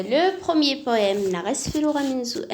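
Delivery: usual speaking voice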